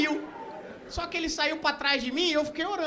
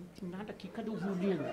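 very soft, low and secretive; hushed urgent aside; 7 semitones lower, 14 dB quieter, faster than usual. Acted. Asking the waiter about the violin secretly, hushed. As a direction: keep it under your breath, into his ear. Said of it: "E falando baixinho no ouvido do cara".

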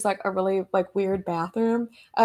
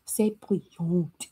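mock French; 4 semitones lower, 4 dB quieter, slower than usual. Switches into French for effect.

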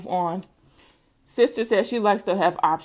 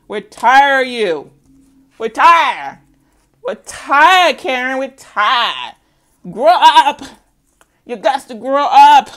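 louder, firm we're tired, grow up; loud scolding; 5 semitones higher, 9 dB louder, slower than usual. Acted. Telling off Karen for not growing up, loud. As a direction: scold them hard and loud. Scolding reading subject loudly.

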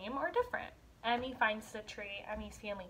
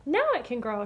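put-on pleased child; 2 semitones higher, 8 dB louder than usual. [name] satisfied.

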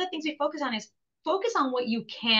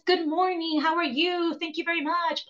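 higher, sing-song parody of politeness; mocking polite greeting; 5 semitones higher than usual. A bartender parodying the customer's polite order, mocking. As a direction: mimic the too-nice customer, teasing. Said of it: "No one in Italy says".

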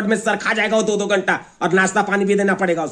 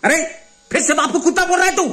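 outraged scolding; 6 semitones higher than usual. Acted. Father scolds son for insulting him.

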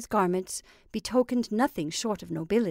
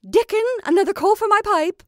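much higher and louder call; sharp cry; 11 semitones higher, 10 dB louder than usual. Acted. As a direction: snap out the demand. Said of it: "cry sharply".

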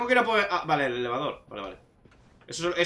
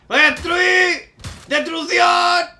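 slow, roaring battle cry; wrestling promo; 14 semitones higher, 11 dB louder, much slower than usual. Pretend. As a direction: bellow it like a wrestler hyping a match. Rampaging office worker in game.

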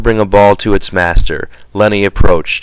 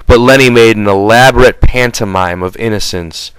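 usual speaking voice

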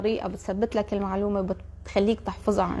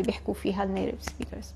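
whisper; 2 semitones lower, 7 dB quieter than usual. Real, not acted. Whispered aside to students.

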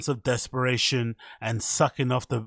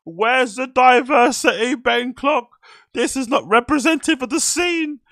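much higher and louder, shrill mock outrage; mock outraged activist; 11 semitones higher, 8 dB louder than usual. Vlogger mocking online critics with mock outrage. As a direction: ham up the indignant social media critic. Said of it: "one of those rah, rah people".

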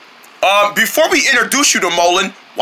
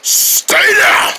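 high, strained battle yell; fight shout; 12 semitones higher than usual. Acted. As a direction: scream it as you slam him down. Shouts while striking opponent.

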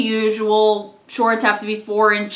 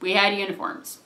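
ordinary delivery

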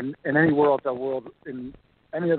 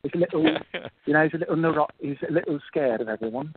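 higher-pitched mock English rocker accent; 4 semitones higher than usual. Shown as a demonstration. Actor demonstrating his character's affected voice, mock English accent. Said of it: "Nigel Spinal Tap sort of accent".

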